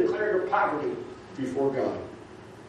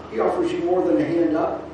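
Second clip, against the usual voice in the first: normal talking voice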